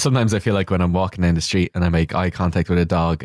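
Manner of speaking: usual speaking voice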